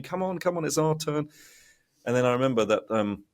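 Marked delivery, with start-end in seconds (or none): 0.0-1.3 high, nagging mock hurry; impatient queue. People in the phone queue hurrying him, impatient. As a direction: hurry them along impatiently. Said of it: "people behind me".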